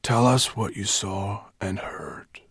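slow, hoarse command; hoarse elder asking for a report. A narrator's reading. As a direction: speak slowly with a husky rasp. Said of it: "he said in a hoarse voice".

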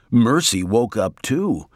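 ordinary delivery

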